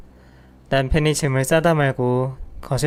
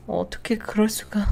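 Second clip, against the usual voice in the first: breathless, higher shocked whisper; stunned whisper; 7 semitones higher, 5 dB quieter than usual. Acted. Wife learning her mother-in-law betrayed her, whispering in shock. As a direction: whisper it in disbelief.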